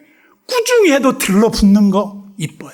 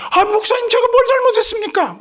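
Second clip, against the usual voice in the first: much higher, anxious church-member voice; startled church member; 4 dB louder than usual. Retold or imitated. Church member caught off guard by the pastor, high flustered reply. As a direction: squeak out a flustered apology.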